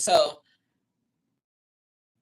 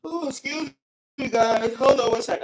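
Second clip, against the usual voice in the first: talking through a yawn; words stretched by a yawn; 8 semitones higher, 9 dB louder than usual. Real, not acted. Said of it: "I'm sorry to yawn like that".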